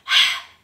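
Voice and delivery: big airy exhale, overdone; loud unvoiced puff of breath; breathy sigh. Shown as a demonstration. Music teacher shows pupils what to avoid, over-breathy sigh. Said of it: "really breathy, so we don't want".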